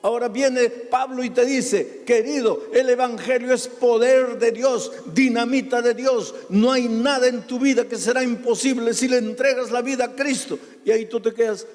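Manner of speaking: high, loud, driving sermon voice; fiery preaching. Acted. Preacher exhorting the congregation, fiery and loud. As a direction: preach it out at full force to the congregation.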